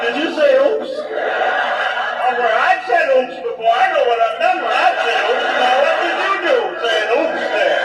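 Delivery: a patient realizing something went wrong; alarmed patient. Retold or imitated. Panicked patient questioning doctor.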